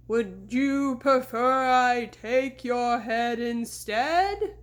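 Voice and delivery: slow, loud ghostly moan. A narrator's reading. Fake horseman threatening again, slow ghostly moan. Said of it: "Sabrina moaned".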